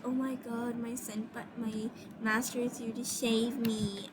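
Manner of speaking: hushed mock adoration; soft, pretend-grateful gushing; happy. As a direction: act out a swooning rescue line, softly. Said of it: "was really happy because".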